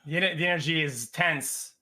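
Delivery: normal talking voice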